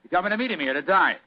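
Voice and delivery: rough common man disguise. Acted. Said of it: "He speaks very rough".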